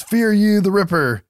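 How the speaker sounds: high-pitched mock yell; half-yelled, high villain call; retold yell. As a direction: imitate the killer's yell without full volume. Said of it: "who yells".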